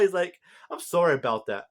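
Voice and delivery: usual speaking voice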